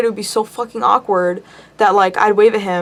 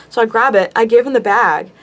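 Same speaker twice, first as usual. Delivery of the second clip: normal talking voice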